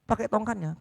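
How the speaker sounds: ordinary talk, nothing marked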